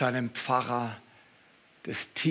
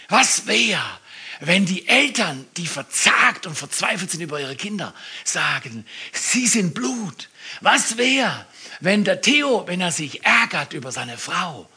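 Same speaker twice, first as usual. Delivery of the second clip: loud, higher, urgent appeal; preaching at full force; 5 semitones higher, 6 dB louder than usual. Acted. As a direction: rouse the congregation. (laute, eindringliche Predigt)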